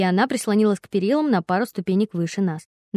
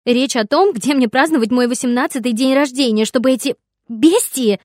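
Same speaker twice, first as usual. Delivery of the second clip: put-on louder, higher indignant girl; heated arguing; 4 semitones higher, 6 dB louder than usual. A narrator's reading. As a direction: spit out the insult. Daughter argues about party. (возмущённо)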